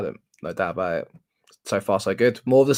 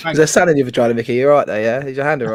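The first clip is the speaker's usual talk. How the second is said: mocking tease; 3 semitones higher, 8 dB louder, faster than usual. Real, not acted. Teasing mate about his hurt hand.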